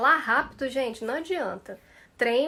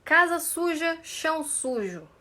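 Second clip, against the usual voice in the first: careful drill; 5 semitones higher than usual. Shown as a demonstration. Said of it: "Coloquem nos comentários".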